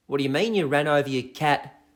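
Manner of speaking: normal talking voice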